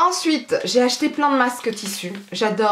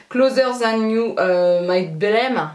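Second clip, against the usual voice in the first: slow, put-on English accent on the polish name; hammed-up English accent for the polish names; mock English accent; slower than usual. Reading polish names to viewers in a mock English accent. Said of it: "mon accent, il est trop bien".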